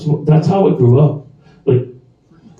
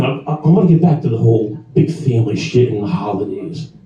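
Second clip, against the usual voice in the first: ordinary talk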